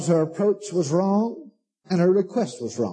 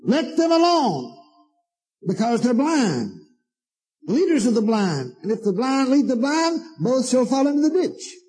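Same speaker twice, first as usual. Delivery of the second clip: scripture reading; 6 semitones higher than usual. Acted. Reading scripture at high preaching pitch.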